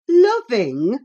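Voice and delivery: loud, scornful rising "Loving!". A narrator's reading. Ellen scorns Cathy's word "loving". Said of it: "cried I, as scornfully as I could".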